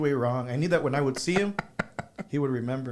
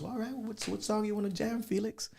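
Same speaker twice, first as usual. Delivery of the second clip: higher, softer Little Joe impression with a rising question; imitating Little Joe; 8 semitones higher, 6 dB quieter than usual. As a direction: lift the voice up high and keep it soft, teasing. Imitates Little Joe asking Felix.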